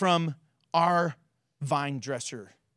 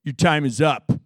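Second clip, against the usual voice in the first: lower, louder final ruling; God telling the tree its time is up; stern verdict; 6 semitones lower, 7 dB louder than usual. Retold or imitated. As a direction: drop the verdict low and firm. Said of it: "And then he said".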